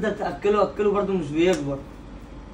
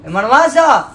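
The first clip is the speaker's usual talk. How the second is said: slow, louder call; drawn-out yell for mom; 5 semitones higher, 9 dB louder, much slower than usual. Pretend. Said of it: "تقول لها ماي ماذر". (نداء ممطوط عالي على الأم)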